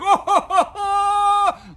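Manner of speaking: big high belly laugh, very loud; hammed-up booming ho-ho laugh; booming jolly laugh. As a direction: imitate a huge jolly laugh. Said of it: "those laughs where it's just like".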